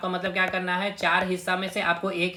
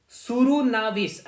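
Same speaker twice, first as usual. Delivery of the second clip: सुरुनाविस stretched slowly at a higher pitch; careful sounding-out; 4 semitones higher, much slower than usual. Shown as a demonstration. Teacher giving students a hard title to memorise, careful sounding-out. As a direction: draw out सुरुनाविस, high then falling. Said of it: "ऐसे ही याद रखिएगा".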